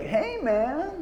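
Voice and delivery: high, drawn-out "hey, man"; lightly voiced cool greeting; Sam Rockwell impression. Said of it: "it's always like".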